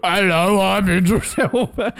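half-hearted impression cracking into laughter; Idris Elba. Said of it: "So the opposite of your impression".

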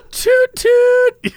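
high, loud toot-toot like a train; playful mimicked train whistle. Celebrating the smooth Lincoln train ride, train whistle toot.

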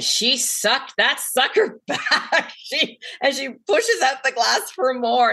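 high, laughter breaking into the words; laughing while telling. Real, not acted. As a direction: tell it through real, delighted laughter. Laughing while recalling friend's toast.

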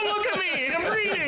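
mocking whiny voice. Mocking the reader.

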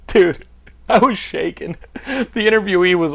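ordinary talk

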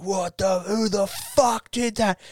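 high-pitched mock outrage; appalled crowd. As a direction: imitate a disgusted bystander demanding who did it. Acting out crowd smelling fart.

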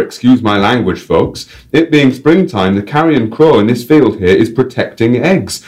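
high, slow, broad North English showman voice; North English guide. Acted. Guide on his radio mic, North English accent. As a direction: announce the bird in a chirpy North English accent. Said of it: "random North English accent".

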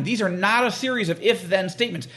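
normal talking voice

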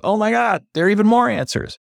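very high, excited retelling; thrilled disbelief, voice leaping up; amazed discovery. Retold or imitated. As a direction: exclaim like you've struck treasure. Retold delight at more answers.

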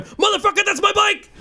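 very high-pitched indignant cry; high, strained retold shout; acted outraged yell. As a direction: yell it at a thief, re-enacting the outrage. Retelling his confrontation with thief.

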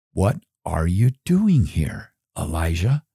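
lightly voiced low, measured divine question; voice of the Lord. God questioning Elijah in the cave, lightly voiced low question. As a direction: ask it low and deliberate, lightly in character. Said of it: "the word of the Lord came to him".

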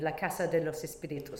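"La Casa de los Espíritus" said with full Spanish vowels and a tapped r; title said the Spanish way, not anglicised; Spanish pronunciation. Shown as a demonstration. Guest naming the book's Spanish title for the host, in native Spanish pronunciation. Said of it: "So I would call it".

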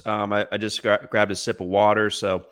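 ordinary talk, nothing marked

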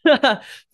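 sputtering laugh mid-choke; cough-laugh on popcorn; choking laugh. Real, not acted. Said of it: "now I'm choking on popcorn".

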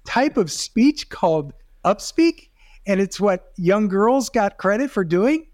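usual speaking voice

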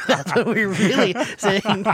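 slow words carried on a real laugh. Real, not acted. Co-host still recovering from the teasing, slow and laughing. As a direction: laugh through the line, drawn out. Laughing after headphones joke.

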